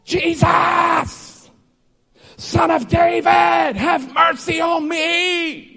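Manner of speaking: high, slow screeching plea; screeching cry. Acted. Blind man screeching to Jesus over the crowd. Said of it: "almost sounded like an animal screeching".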